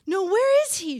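loud, high panicked screech; frantic old woman's screech at midnight. Acted. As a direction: screech it in alarm. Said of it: "she screeches".

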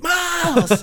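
high mock yell across the street; imitated yell. Eddie spotting Miles from across the street, high calling yell. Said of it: "he'll yell, like, across the street".

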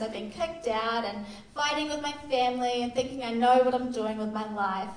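usual speaking voice

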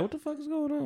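half-hearted imitation of a shocked father; baffled dad. Father hearing broke suitor's proposal, high mock disbelief. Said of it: "dad was just like".

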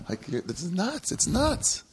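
breaking into high laughter through the words; can't get it out for laughing. Real, not acted. Laughing at a friend's Instagram line.

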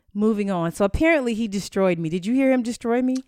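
normal talking voice

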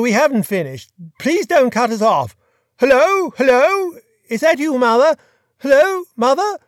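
loud, high Hello? Hello?; calling into phone. A narrator's reading. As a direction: shout down a failing line. Peter calling, line cutting off.

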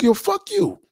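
high-pitched playful snap back; mock indignant. Bathroom-shamed friend snaps back in high mock outrage. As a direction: fire back at him, half-joking. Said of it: "You're like".

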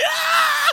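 sudden high joyous yell; crowd cheer. Retold or imitated. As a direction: let out a wild stadium whoop. Crowd going crazy, cheering.

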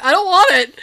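high loud laugh-crying plea; high, loud, half-laughing whine; laughing nervous plea. Retold or imitated. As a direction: plead through nervous laughter. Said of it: "Because I'm nervous".

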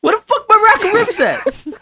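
2 high, incredulous cry of outrage; 3 shocked squawk at the empty fridge; 1 outraged disbelief. Retold or imitated. 4 robbed boyfriend finds his ribs gone, high disbelief.

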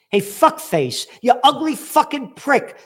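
furious shouted insult at a politician; angry insult. Real, not acted. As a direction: yell it at him in contempt. Ranting at Schultz.

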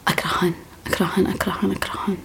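hushed, breathless repeated whisper; whispered hate. Real, not acted. To viewers about hated cats, a hushed whisper. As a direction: whisper it with real dread. Talking of her dread of cats.

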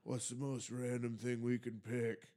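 lightly put-on laid-back hippie. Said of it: "is that your hippie voice?".

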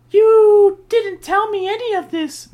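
high, loud, slow mother's voice. Acted. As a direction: play the upset mom, high and loud. Mrs Brewer voiced in story.

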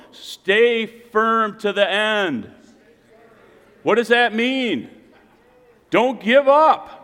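high, loud, emphatic preaching; preaching exhortation. Real, not acted. Preacher urging congregation to stand firm, forceful preaching. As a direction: ring it out to rouse the congregation.

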